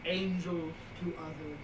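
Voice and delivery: a modest, not-that-good movie villain impression; Pinhead. Horror fan quoting the movie to co-host, half-hearted Pinhead. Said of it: "I had to do my Pinhead impression".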